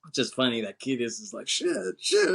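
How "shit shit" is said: very high-pitched panicked cursing; mock freak-out. [name] seeing cops outside, mock freak-out. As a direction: curse in put-on panic. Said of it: "He's, like, freaking out".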